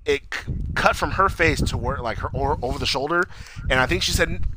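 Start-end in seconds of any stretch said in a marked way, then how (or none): none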